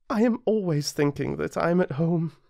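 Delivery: put-on high, light boyish voice, eager; a small prince asking his friend eagerly; little prince. Narrator voices the little prince, high and eager. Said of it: "you laughed to yourself and said".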